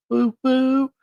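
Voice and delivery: high teasing woo, woo; playful high hooting; mock cheer. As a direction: hoot at them like a cheeky fan. Teasing cheer at the co-worker news.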